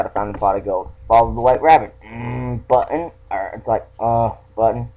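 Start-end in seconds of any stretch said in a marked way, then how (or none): none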